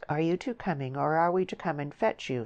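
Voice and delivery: ordinary delivery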